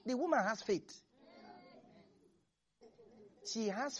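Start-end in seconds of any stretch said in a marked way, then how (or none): none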